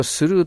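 "スルー" with a Japanese s and a long "ū"; wrong reading through. Shown as a demonstration. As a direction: misread thorough as a katakana "surū". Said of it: "スルーと読んだら沈没しますよ". (間違って「スルー」と読む)

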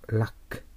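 ラック with a short "a" from the back of the nose; short central vowel in ラック; English "luck". Shown as a demonstration. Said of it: "鼻の奥であと言います".

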